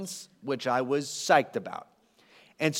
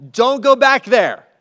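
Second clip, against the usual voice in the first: loud, higher, slow urging; urgent plea; 7 semitones higher, 12 dB louder than usual. Acted. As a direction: call them back with force. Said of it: "Paul is urging them".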